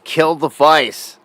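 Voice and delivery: half-shouted quote with stretched words; retold yell. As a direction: imitate a heckler's yell, slowly.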